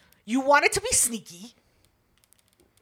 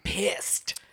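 whisper; 3 semitones lower, 7 dB quieter, slower than usual. Real, not acted. Aside about sister's anger.

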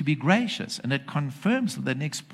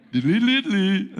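mock singsong; 7 semitones higher, 5 dB louder than usual. Mocking singsong after the taunt.

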